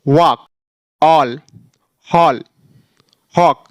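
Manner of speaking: ordinary talk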